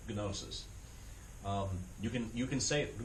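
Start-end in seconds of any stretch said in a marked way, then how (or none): 0.1-0.6 "gnosis" with the G sounded before the N; Greek pronunciation. Shown as a demonstration. Teacher showing how you would say it in Greek, hard G in "gnosis". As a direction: say "gnosis" starting on a hard G, then N. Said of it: "That's how you would say it".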